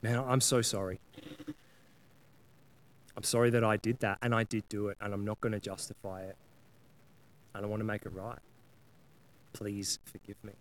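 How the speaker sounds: quietly mean every word of sorry; earnest apology. Retold or imitated. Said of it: "an earnest, heartfelt ownership".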